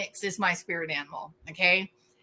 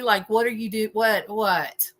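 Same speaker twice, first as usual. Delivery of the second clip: ordinary talk, nothing marked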